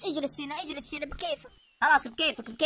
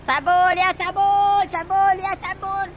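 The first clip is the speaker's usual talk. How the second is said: slow, loud drawn-out call; 8 dB louder, much slower than usual. Real, not acted. Calling his sidekick from across the field, slow loud call. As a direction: stretch the name out loud.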